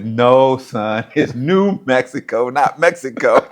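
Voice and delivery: loud, slow, high stress on "New"; comic correction. Retold or imitated. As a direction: hit "New" hard, as an exasperated coach. Coach correcting him, comic retelling.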